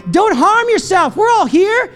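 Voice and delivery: high, urgent stretched-out shout. Acted. Paul shouts to the jailer not to harm himself, shouted. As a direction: call out to stop him. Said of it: "But Paul shouted".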